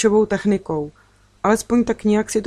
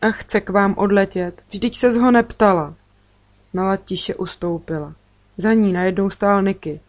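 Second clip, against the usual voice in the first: ordinary delivery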